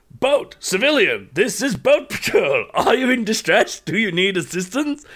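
hammed-up official hail to a ship; mock patrol officer. Friend hailing players in game chat, in a mock official voice.

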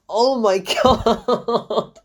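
drawn-out oh my God dissolving into laughter; laughing disbelief. Real, not acted. Critic recalling the terrible camerawork, laughing. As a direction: crack up in slow disbelief. Helpless laughing at awful film.

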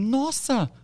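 high amazed "Nossa!"; blurted wow at the new followers; surprised gasp. Retold or imitated. Influencer telling a friend about his follower count, astonished high exclaim. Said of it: "Eu falei".

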